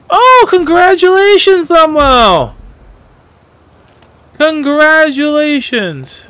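loud, high shout of congratulations; excited congratulations. Real, not acted. Streamer congratulating a viewer on a win, loud excited cheer. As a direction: cheer him on, big and bright.

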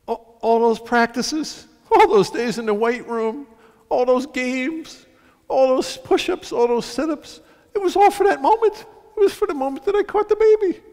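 high, building, heartfelt realisation; imitating moved rescuer. Football player realizing what his training was for, impassioned. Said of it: "and he goes, I suddenly realized".

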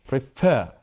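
"prefer" with FER punched; stress on second syllable. Shown as a demonstration. Teacher showing students word stress, "prefer" stressed on FER. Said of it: "I'm stressing the second syllable".

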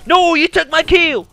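high, loud indignant yell; kill-steal rage; outraged shout. Real, not acted. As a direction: shout at the teammate who stole it. Teammate stole his kill.

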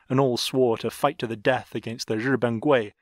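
ordinary delivery, nothing marked

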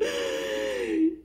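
sharp, high, voiceless gasp of fright; mock gasp. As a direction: act out the gasp of shock. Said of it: "I'm like".

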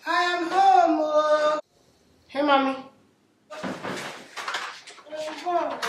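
scolding Nigerian mum voice; West African accent. Acted.

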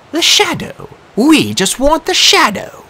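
high, slow, cold voice of an ancient warrior woman; drawn-out and otherworldly, each word held; eerie Disir voice. A narrator's reading. Disir at the piano making her demand in a high eerie voice.

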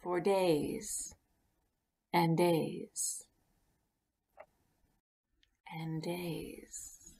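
fading from soft to whispered; fading away. A narrator's reading. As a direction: let the days fade into silence. Narrator fades time passing.

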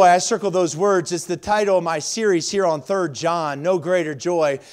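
normal talking voice

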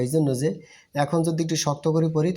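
ordinary talk

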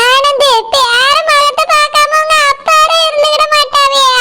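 crying wail; tearful plea. Acted. Grandmother pleading to see grandson, tearfully wailing. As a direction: plead with the son through tears.

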